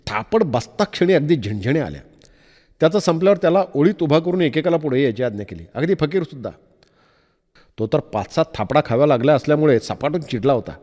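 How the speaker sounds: ordinary delivery, nothing marked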